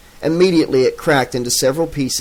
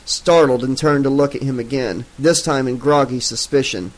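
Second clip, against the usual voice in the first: ordinary delivery, nothing marked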